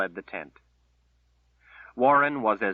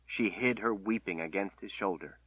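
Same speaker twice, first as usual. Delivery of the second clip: plain reading voice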